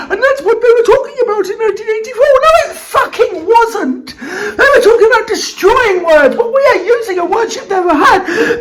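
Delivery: ordinary talk, nothing marked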